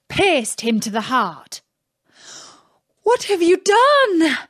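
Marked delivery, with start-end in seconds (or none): 3.0-4.4 shocked princess, much higher and louder; princess cries out. Acted. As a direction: cry out in horror as the princess. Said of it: "cried the princess".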